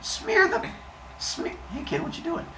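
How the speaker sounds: lightly voiced high, indignant Apollo; a hint of a scandalized god; outraged Apollo. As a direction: play Apollo as mock-shocked, voice squeaking up. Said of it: "He was like".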